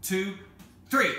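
high, loud count-in for a yell; counting shout. Acted. Children on the tower count to yell together, shouted count. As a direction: count off loudly, rising to three.

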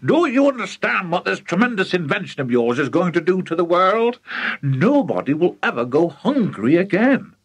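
impassioned, excited proclaiming; worked up. Acted. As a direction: work yourself up as you go. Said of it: "working himself up so much".

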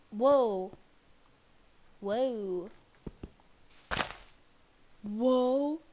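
sudden startled whoa. Real, not acted. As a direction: react like you nearly fell. Startled exclamations.

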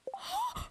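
sharp high gasp of delight; excited gasp. Real, not acted. Player spotting carrot seeds gasps in delight.

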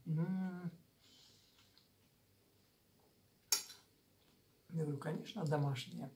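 talking around food; chewy muffled words; mouth full, chewing. Real, not acted. Seen 2: spoonful goes in at 0.2–1.1, then she talks while chewing.

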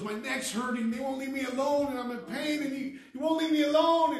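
imitated high-pitched, agitated complaint; tormented man's outcry. Preacher acting out a hurting homeless man, high distressed outcry. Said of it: "He's cussing and screaming".

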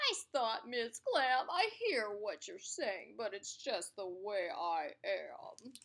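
mopey fish voice, slowing at the end; glum pout fish. Acted. The fish answering the clam, glum. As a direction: shrug it off sulkily. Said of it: "glum, gloomy swimmer".

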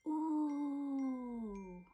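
breathless ooh; awed at the sparkle; gasp of wonder. Acted. Mouse gasping at the star. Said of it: "she gasped".

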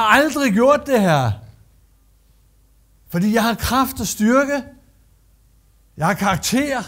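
loud, proud self-righteous protest; proud old Peter. Acted. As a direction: boast of never breaking the law. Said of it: "i rollen som den gamle Peter".

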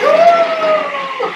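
long, very high, loud "woo"; mock appliance squeal of joy; excited whoop. Food processor celebrates the show, long high whoop.

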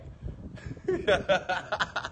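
breathy run of chuckles; real laughter. Real, not acted. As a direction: crack up briefly, mostly breath. Laughs at real crack remark.

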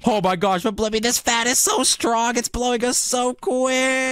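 high-pitched strained cry; playful high alarm. Pretend. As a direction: call out as if being blown away, stretching the end. Fan blowing them away.

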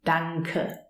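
danke as "dang-kuh", joined up; throat-formed NK. Shown as a demonstration. Teacher repeating what we say, danke with back ng. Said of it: "But we say danke".

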